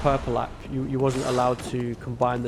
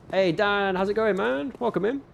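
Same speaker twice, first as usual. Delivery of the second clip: high, bright welcome rising higher; excited greeting; 7 semitones higher than usual. Real, not acted. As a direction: greet the newcomer with real excitement. Greeting new viewer.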